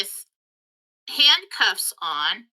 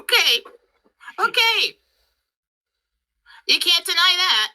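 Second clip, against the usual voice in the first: mock astonishment; 9 semitones higher, 3 dB louder than usual. Retelling shocked acceptance.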